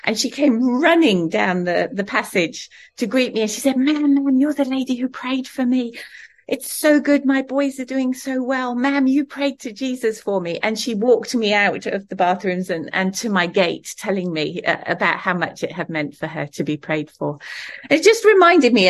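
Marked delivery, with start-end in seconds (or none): 3.8-10.6 higher, eager voice of a grateful woman; excited greeting. Retold or imitated. Grateful attendant greets her excitedly. 17.9-18.9 higher and louder; emphatic burst. Real, not acted. Emphatic turn after the story.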